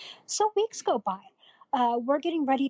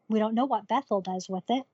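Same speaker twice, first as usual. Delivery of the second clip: ordinary talk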